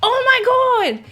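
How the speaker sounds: real astonishment at the news; real surprise stretched out; amazed. Real, not acted. Learning Ottawa did the same.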